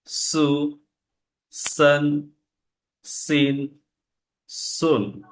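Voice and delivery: সা সি সু সান সিন সুন, each said with a crisp hissed স; syllable drill. Shown as a demonstration. Teacher drills vowel marks on স for the class. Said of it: "উচ্চারণটা হচ্ছে সিন".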